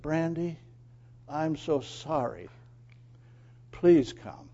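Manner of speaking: soft, coaxing plea; gently coax, slowing on the apology; tender apology. Acted. Owner kneels to his frightened dog, tenderly apologizing.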